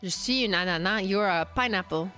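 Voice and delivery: ordinary talk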